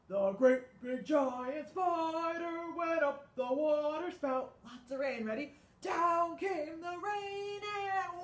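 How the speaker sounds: loud, slow, booming sung; great big spider voice. Shown as a demonstration. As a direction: sing it like a huge spider. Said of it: "Can you sing in your great big spider voice?".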